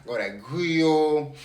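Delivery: loud, punchy hype delivery; hyped-up self-quote. Retold or imitated. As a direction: replay your lively intro, stretching the last phrase. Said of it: "Mine was like".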